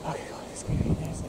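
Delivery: hushed whisper; nervous night vlog whisper. Real, not acted.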